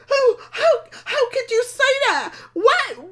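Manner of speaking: high, loud, tearful outrage; imitating a hurt friend shrieking back, crying; outraged disbelief. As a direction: friend snapping back through tears on the phone, outraged. Friend reacts to being congratulated.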